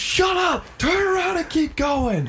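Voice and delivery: high-pitched hammed-up yell; mock angry shout. Friends ordering a swimmer onward, mock shout. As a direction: holler it high at someone far out.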